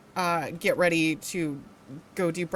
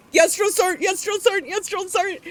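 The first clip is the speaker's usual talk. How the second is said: pitched up high and loud, urgent; a private in pain, desperate to go; desperate high yes drill sergeant; 10 semitones higher, 4 dB louder, faster than usual. Retold or imitated.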